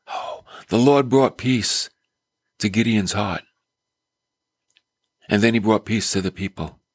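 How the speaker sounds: ordinary delivery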